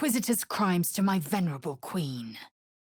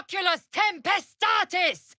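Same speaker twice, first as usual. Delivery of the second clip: high commanding spell cry; high chanted command; shouted incantation; 11 semitones higher than usual. Acted. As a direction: hurl the spell words.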